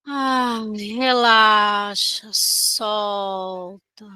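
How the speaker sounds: ordinary talk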